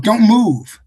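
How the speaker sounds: loud, high-pitched order that cuts through; shouted command. Acted. Agents who always shout at suspects, shouted command. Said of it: "The ones who always shout".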